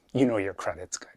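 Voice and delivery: hushed confiding whisper. Acted. Bartender assuring the snowman quietly, a whisper. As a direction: lean in and whisper it.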